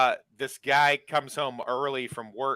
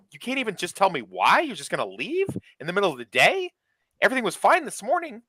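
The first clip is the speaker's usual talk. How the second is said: higher, pleading mock distress; upset boyfriend; 7 semitones higher than usual. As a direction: demand answers in a hurt, raised voice. Boyfriend demanding explanation.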